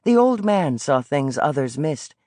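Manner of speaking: plain reading voice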